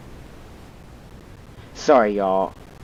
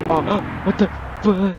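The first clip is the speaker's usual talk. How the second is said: startled gasp; 8 semitones higher, 4 dB quieter than usual. Real, not acted. Startled by something in game.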